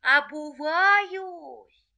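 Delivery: high, very drawn-out cry; frightened fox. Acted. Fox stalls the rooster, frightened. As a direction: stretch the word out high. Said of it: "испугалась и говорит".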